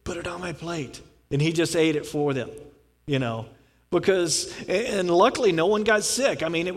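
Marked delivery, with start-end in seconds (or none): 0.0-1.0 quiet, kind offer to take the food; soft offer. Retold or imitated. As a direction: say it softly, helping the ladies out. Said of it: "just goes".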